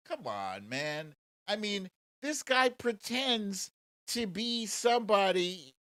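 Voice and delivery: ordinary delivery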